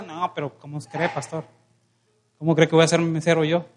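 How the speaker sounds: low, soft objection; acted disbelief, kept low and under the voice; mock reluctant protest. Church brother balks to pastor at waiting tables, low reluctant protest.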